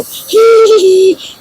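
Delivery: very high, squeaky giggling hee hee for the gun. Retold or imitated. Painter voicing the spray gun's fine mist, squeaky high giggle. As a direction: squeal it like a tiny toy spray. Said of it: "pull the trigger a little bit and go".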